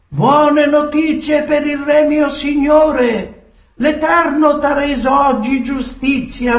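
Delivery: high-pitched, declaimed announcement; heralding messenger. Acted. Ethiopian brings the king news of victory, proclaiming. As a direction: proclaim good news to your lord.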